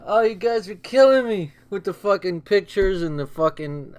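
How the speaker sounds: normal talking voice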